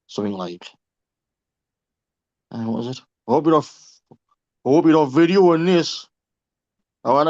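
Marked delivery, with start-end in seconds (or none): 3.3-6.1 louder, wary mock voice of a dodgy lad; suspicious local lad. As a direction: voice the suspicious guy warning you off. Quoting a suspicious dealer.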